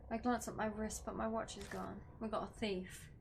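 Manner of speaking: ordinary delivery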